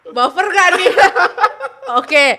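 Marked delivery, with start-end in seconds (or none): 0.9-2.0 loud, high laugh; bright laughing burst; burst of laughter. Real, not acted. Laughs at the trick game.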